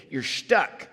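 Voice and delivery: ordinary delivery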